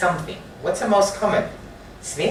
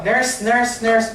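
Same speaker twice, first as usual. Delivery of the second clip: rude calling out; 8 semitones higher, slower than usual. Shown as a demonstration. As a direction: call out the repeated nurse rudely across the ward. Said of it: "that's rude".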